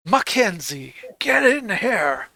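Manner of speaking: gruff boss calling someone in; gruff shout. Acted. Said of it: "you hear".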